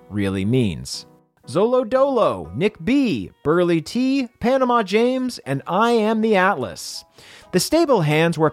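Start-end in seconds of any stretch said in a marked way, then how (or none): none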